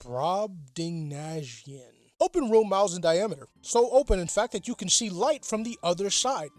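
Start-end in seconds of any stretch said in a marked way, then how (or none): 0.0-1.9 very slow, savoring each syllable; drawn-out finale. Acted. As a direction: stretch the last big word out. List of huge words ends.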